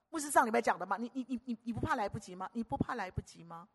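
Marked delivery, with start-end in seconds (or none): none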